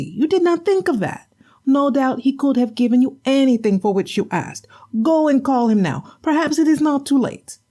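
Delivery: high, nagging wife's voice; scolding wife. Acted. Wife scolding her husband for an empty catch.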